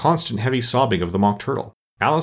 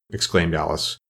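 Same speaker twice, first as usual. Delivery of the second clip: usual speaking voice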